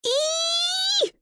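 very high excited squeal. A narrator's reading. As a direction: squeal with pure delight. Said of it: "squealed with excitement".

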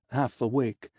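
ordinary delivery

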